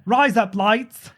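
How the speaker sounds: "Rise up lights" slowed right down in a put-on Australian voice; Australian accent. Shown as a demonstration. Repeating the teacher's joke line in an Australian accent. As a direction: drawl it like an Aussie. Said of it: "That's razor blades in Australian accent".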